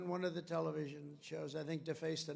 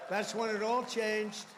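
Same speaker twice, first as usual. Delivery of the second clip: louder, slower rally line; emphatic repeat; 4 semitones higher, 7 dB louder, slower than usual. Real, not acted. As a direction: drive it home to the crowd, louder and slower.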